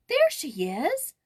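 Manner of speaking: high, loud, slow excited cry. Acted. Joyce spotting the portrait, excited high cry. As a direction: cry out, pointing in thrill. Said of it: "she cried".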